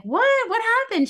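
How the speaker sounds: very high, loud disbelieving What?; shocked. Retold or imitated. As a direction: squeal it in stunned disbelief. Retold shock at being banned.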